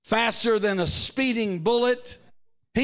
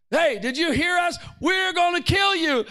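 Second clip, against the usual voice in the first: mock guard's threat; 9 semitones higher than usual. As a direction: yell it at the prisoner like a jailer. Guards threaten Paul, acted.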